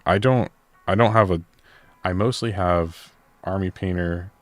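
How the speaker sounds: normal talking voice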